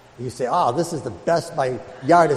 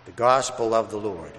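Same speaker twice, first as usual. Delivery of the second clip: gospel reading; 4 semitones lower, 6 dB quieter than usual. Acted. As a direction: read it reverently, unhurried, to the congregation. Priest proclaims the Gospel.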